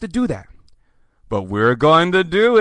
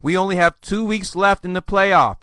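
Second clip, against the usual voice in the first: normal talking voice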